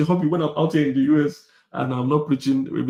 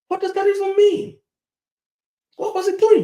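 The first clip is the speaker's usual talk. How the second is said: much higher, louder mock disbelief; incredulous shriek; 4 dB louder than usual. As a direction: shriek it like it's absurd. Mocking the "provider" idea.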